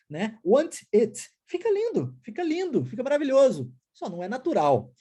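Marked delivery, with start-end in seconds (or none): 0.4-1.3 "Want it" as two separate words, both Ts hit; over-articulate "Want it", every T sounded; robotic textbook English. Shown as a demonstration. Teacher mocks robotized classroom English, stiffly articulated. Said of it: "um inglês robotizado". 1.4-3.7 high-pitched ironic praise; mock admiration. Teacher mocks robotized English, ironic admiration.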